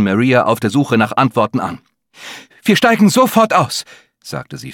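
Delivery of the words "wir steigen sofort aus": high, strained attempt at calm; trying to hide the panic from kids; teacher trying to calmly tell children to get off; failing to sound calm. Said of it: "versuchte ruhig zu klingen und scheiterte kläglich".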